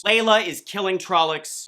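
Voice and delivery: ordinary talk, nothing marked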